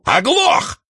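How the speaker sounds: very high, furious bark; furious thunder. A narrator's reading. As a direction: roar at him in fury. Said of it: "он бешено загремел".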